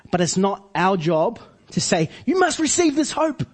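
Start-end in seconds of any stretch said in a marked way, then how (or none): 2.3-3.4 high-pitched, pushy mock demand; mock insistence. Preacher imitating a pushy believer pressing someone, mock insistent.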